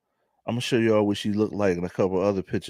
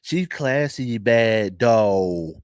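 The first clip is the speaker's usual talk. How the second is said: slow Philly accent, stretched vowels; much slower than usual. Real, not acted. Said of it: "You can hear all my Philly accent".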